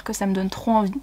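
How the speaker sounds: ordinary talk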